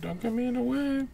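"Don't get me in the web" said high and soft; high mock voice. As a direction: squeak it up in a high put-on voice. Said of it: "repeated line, high take".

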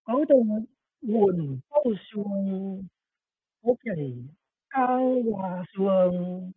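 put-on very slow, drawn-out little girl voice; little girl voiceover. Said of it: "I'll do this again".

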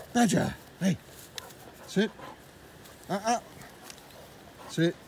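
short, firm commands to a dog. Real, not acted. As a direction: keep the dog in check. Training dog to sit and hold.

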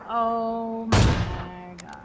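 drawn-out reaction. Real, not acted. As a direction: draw every word right out in disbelief. Reacts to teammate's sniper shot.

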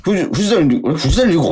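replaying a startled double take; retold double take. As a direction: stumble over the name in mock shock. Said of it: "と思って".

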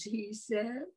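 ordinary delivery

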